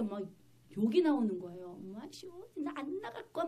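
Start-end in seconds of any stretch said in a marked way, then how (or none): 2.1-3.5 high, put-on demon voice; imitated defiant demon. Preacher voices the spirit refusing to leave, mock defiance. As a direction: mimic a spirit refusing to budge. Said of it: "막 이러면서 막 그러는 거예요, 얘가".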